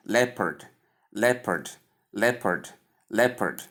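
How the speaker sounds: "leopard" as LEP-erd, each falling after a short pause; blended sounds of leopard. Shown as a demonstration. Teacher combining the sounds for learners, "leopard" as LEP-erd. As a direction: repeat "leopard" evenly, short e then schwa. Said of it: "when we combine all these sounds".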